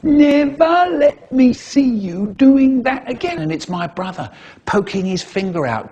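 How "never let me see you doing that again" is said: high, louder mimicking of the teacher; mock her in a silly high voice; funny mocking voice. Brother mocking the teacher behind her back, high and silly. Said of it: "a funny voice going".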